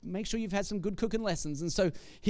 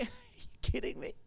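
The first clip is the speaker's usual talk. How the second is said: laughing to herself; 9 dB quieter than usual. Retold or imitated. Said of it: "she laughs to herself".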